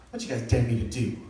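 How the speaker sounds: low, soft mock tough guy; a hint of a six-year-old playing thug; lightly voiced, low thug swagger; thug talk. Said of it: "I had talk thug for some reason".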